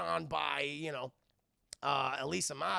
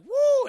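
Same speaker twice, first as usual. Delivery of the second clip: loud, high woo; mock delighted squeal; excited whoop; 16 dB louder than usual. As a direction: let out a high, ringing whoop. Transformed Elisa whooping.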